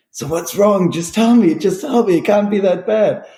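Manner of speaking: high, urgent imitated brother; brother pleading. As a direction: press him to just say it. Brother pressing him to talk.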